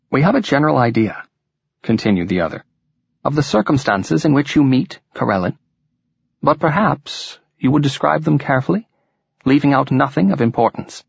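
courteous lilting Welsh leader; Welsh accent. A narrator's reading. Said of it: "in a musical Welsh accent".

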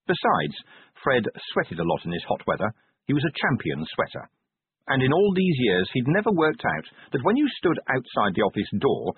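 ordinary delivery, nothing marked

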